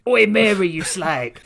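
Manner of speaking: hammed-up London Cockney yell; loud Cockney shout. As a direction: bellow it in a mock Cockney accent. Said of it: "it's like I'm there".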